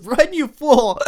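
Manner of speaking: high, loud panicked shout; panicked yell. Retold or imitated. Homer yelling at Bart to flee the scary noise, loud and high. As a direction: scream it like you're fleeing in terror. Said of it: "And Homer goes".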